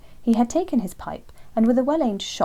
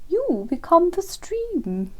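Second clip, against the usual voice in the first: slow, high, lofty lecturing owl voice; superior wise owl; 10 semitones higher, 5 dB louder, much slower than usual. Acted. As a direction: explain like a grand old teacher, slowly.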